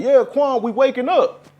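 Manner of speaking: loud, high mock cheer. Mocking a believer bragging about waking up, loud and high. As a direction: ham it up as a proud newcomer.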